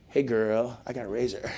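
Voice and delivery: low, soft come-on; quietly smug mock swagger; playing it cool. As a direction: purr it low, mock flirty show-off. Acts showing off flip phone.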